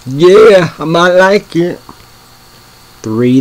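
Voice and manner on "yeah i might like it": excited. Real, not acted. Pleased by a pick on the list.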